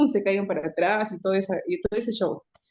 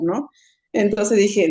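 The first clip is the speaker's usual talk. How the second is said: ordinary talk, nothing marked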